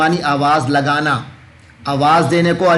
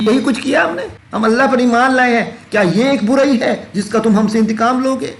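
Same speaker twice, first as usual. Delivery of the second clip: indignant protest; 8 semitones higher, faster than usual. Acted. Believers protesting to People of Book.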